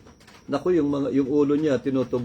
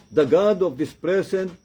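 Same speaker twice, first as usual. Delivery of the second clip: slow, raised verse quote; scripture reading; 5 semitones higher, 3 dB louder, slower than usual. Acted. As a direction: proclaim Paul's words slowly. Quotes Paul's verse aloud. (mabagal at mataas na pagbasa ng talata)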